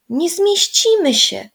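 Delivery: put-on high, slow, doubtful little girl; worried child voice, high and drawn out. A narrator's reading. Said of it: "powiedziała Zosia".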